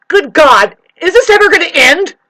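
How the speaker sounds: exasperated cry. Retold or imitated. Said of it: "people going like this and saying".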